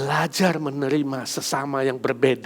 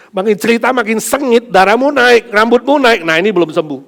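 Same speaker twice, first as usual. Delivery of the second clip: louder, higher, faster heated preaching; preaching at full force; 8 semitones higher, 11 dB louder than usual. Acted. Pastor warning the congregation about grudges, fiery and loud. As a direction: stir them up with rising heat.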